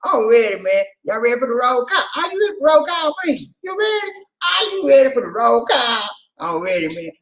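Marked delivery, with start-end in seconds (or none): 0.1-6.1 high, loud hype-man shouts; rally the crowd for a roll call; excited, rising shouted questions; hyped-up calling out. Real, not acted. Hypes audience for the roll call.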